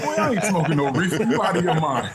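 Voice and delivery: loud, high mock outrage; indignant grandmother. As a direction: scold the grandson like an appalled grandma. Grandmother indignantly refuses to smoke.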